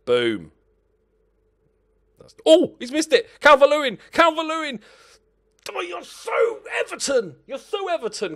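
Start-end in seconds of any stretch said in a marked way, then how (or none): 2.2-8.3 high, loud exasperated yell at the striker; cracked high shout of frustration; frustrated shout. Real, not acted. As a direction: scream in disbelief at a sitter missed. Missed chance, frustrated outburst.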